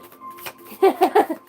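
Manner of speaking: a bright high burst of laughter; delighted laugh. Real, not acted. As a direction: laugh out loud, charmed by the deck. Shuffling a new deck.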